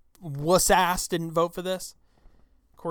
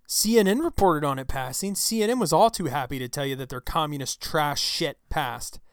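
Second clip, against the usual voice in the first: ordinary talk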